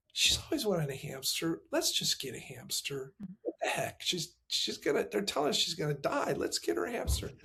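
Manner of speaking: quiet aside between two parents; hushed retold discussion. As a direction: drop to a confidential undertone. Retold private talk between parents.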